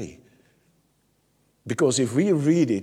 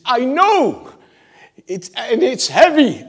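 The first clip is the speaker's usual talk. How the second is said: strained complaint; 11 semitones higher, 9 dB louder than usual. Acted. Complaining prayer voiced.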